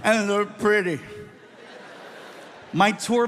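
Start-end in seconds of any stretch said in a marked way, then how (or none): 0.0-1.0 put-on high, loud, slow drunken whine; drunk whiny plea. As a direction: plead like a wasted child. Drunk pleading with tour manager.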